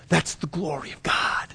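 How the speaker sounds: soft, breath-only whisper; hushed awe. Real, not acted. Preacher closing on caring for the least, whispered. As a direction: drop to a reverent whisper.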